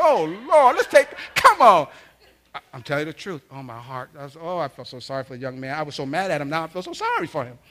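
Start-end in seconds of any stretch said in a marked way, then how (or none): 0.0-1.9 high, very loud plea as dad; dismayed pleading. Retold or imitated. Dad begs as mom offers trash bags, high loud pleading. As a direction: act out a father crying out to intervene.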